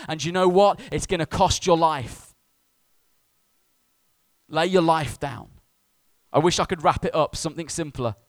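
loud, high preacher's cry; impassioned preaching. Real, not acted. Preacher demanding all of the congregation's life, full-force preaching. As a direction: hammer it home, stretching the key word.

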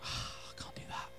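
sighed, unvoiced whisper; defeated sigh. Worshipper facing the greatest commandment, defeated whisper. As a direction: breathe it out as a hopeless admission.

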